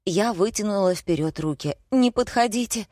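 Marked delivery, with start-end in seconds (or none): 1.9-2.9 higher-pitched, mildly panicked; alarmed warning. A narrator's reading. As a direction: ward someone off in alarm. Backing away from red shirt.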